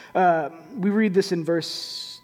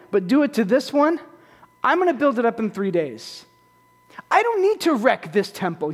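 voicing Jesus; 4 semitones higher than usual. Preacher voices Jesus to the temple crowd, higher and commanding. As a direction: declare it as Jesus rebuking the temple. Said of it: "What Jesus is saying is".

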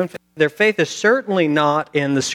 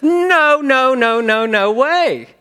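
high, loud string of protests; emphatic refusal; 9 semitones higher, 6 dB louder, much slower than usual. Acted. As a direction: refuse loudly, stretching the final words.

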